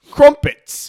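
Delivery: loud, slow mock English accent. Host shows his bad attempt, mock English accent. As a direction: drag it out in a silly English accent. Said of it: "when I try an English accent".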